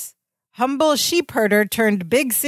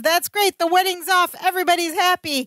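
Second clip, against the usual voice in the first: high-pitched, eager retold cheer; excited, happy; 7 semitones higher than usual. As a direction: act out the one person thrilled by it. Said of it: "the only one excited who seems happy".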